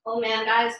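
ordinary talk, nothing marked

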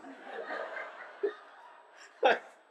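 loud airy laughter; real laugh at a fond memory; breathy laugh. Real, not acted. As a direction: let the laugh spill out. Laughing at the possum story.

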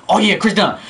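usual speaking voice

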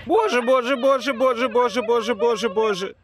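pained repeated moaning; groaning through the burn; mouth burning from spicy food. Real, not acted. Repeating in pain after pepper.

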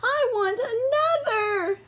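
put-on high sobbing baby voice; crying baby mouse. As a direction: wail like an unhappy baby. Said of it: "But Baby Mouse said".

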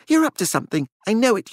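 high, wild distrust. A narrator's reading. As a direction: accuse her, losing control. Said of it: "his voice still wild".

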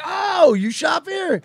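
high, loud fake astonishment; exaggerated, high-pitched "fancy seeing you"; mock surprise. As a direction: squeal it like a staged chance meeting. Acted mock surprise at a shopper.

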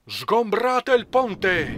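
captain clearing the deck, slow drawn-out shout; captain's shout. A narrator's reading. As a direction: roar the order across the storm-swept deck. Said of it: "gridò il capitano".

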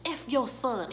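a hint of a robber taunting; mock contempt. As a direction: spit it at the father's picture. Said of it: "he says".

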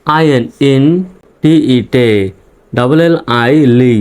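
ordinary talk

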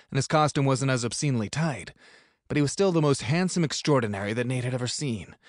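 plain reading voice

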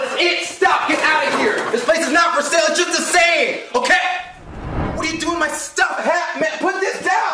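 high, angry outburst; exasperated yelling. Acted. Host ordering intruders out of his home, exasperated. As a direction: yell in mounting exasperation.

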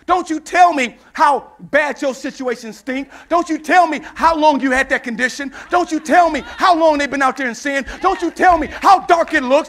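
insistent preacher building to a shout; full-force preaching. Real, not acted. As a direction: drive each refrain harder into the congregation.

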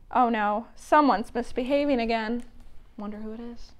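ordinary talk, nothing marked

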